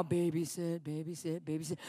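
low, soft repeated aside; quick private reminder to stay calm; muttered self-reminder. Retold or imitated. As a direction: chant it under the breath. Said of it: "babysitting my soul".

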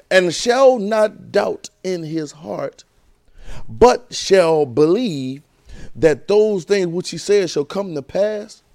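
very slow, drawn-out scripture; dwelling on the promise; slow emphasis. Acted. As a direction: stretch each word out.

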